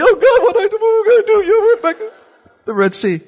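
high, frantic mock panic; panicked Moses. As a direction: squeak out Moses' private panic. Moses panicking when nobody looks.

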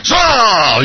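high, loud mock-wrathful cry; angry voice from heaven. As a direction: call the name in exaggerated fury. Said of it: "I don't think this is angry".

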